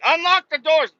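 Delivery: high, loud demanding yell; hammed-up shout from a homeless man; mock homeless guy. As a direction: bang on the car and holler. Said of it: "And they were like".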